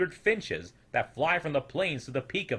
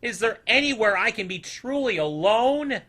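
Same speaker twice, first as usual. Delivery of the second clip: moaning plea; 10 semitones higher, 7 dB louder than usual. A narrator's reading. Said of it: "he said with a moan".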